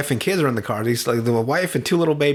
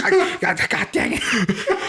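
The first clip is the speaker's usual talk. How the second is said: high, drawn-out sputter of frustration; mock flustered fumbling; 8 semitones higher, slower than usual. Gangster fighting to hold on to the speaker, high mock sputtering. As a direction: stammer it out high, as if losing your grip. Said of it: "I call it the speaker fumble".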